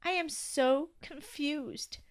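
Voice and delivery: slow, high, mild whimper. A narrator's reading. Toby told he must move out, whimpering. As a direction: draw it out in bewilderment. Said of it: "whimpered Toby".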